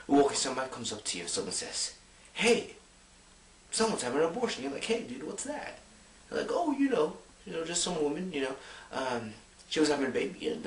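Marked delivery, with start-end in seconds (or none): 2.3-4.6 much higher voice calling over a friend; imitated news-bringer. Imagined acquaintance tells you of an abortion, put-on high voice. 4.8-5.8 high, soft puzzled question; imitated puzzled friend. Imagined friend asks what abortion is, put-on high puzzled voice. 6.5-10.7 high, offhand put-on voice; imitated casual explainer. Imagined acquaintance explains abortion to a friend, mock-casual high voice.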